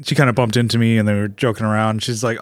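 ordinary talk

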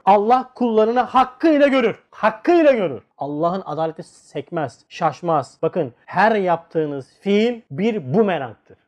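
normal talking voice

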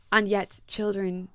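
ordinary talk, nothing marked

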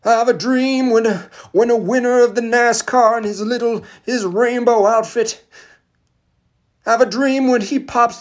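ringing mock oratory with long-held vowels; MLK impression. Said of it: "M L K".

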